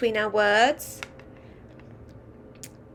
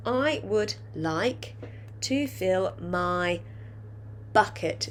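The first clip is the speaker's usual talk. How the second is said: slow, stretched sentence for dictation; slow dictation to young pupils; slower than usual. Shown as a demonstration. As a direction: say each word slowly for the class to write. Teacher dictating the next line.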